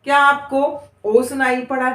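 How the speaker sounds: normal talking voice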